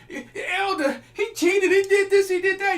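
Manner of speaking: high, loud whiny complaint; mock a wife tattling to the elder, high and loud; complaining wife. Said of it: "When it comes".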